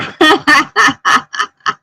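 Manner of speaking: big amused laugh; high burst of laughter. Real, not acted. Laughs at chat joke.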